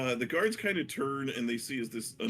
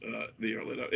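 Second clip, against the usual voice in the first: ordinary talk, nothing marked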